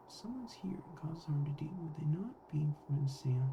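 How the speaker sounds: whispered under the breath. Real, not acted. Whispering the theory to herself. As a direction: breathe it so only you can hear. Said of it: "Hang on".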